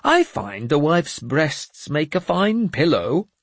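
high, slow and cheerful; pleased with his own wisdom; bright. Acted. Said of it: "Jeremias said brightly".

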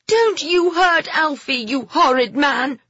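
put-on high, loud girl's scream. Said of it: "Phoebe screamed".

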